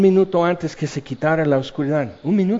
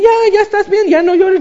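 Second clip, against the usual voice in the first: much higher, louder hammed-up soothing; calm someone who is sobbing; soothing rescuer; 8 dB louder than usual. Rescuer on the beach hushing the castaway, high mock soothing.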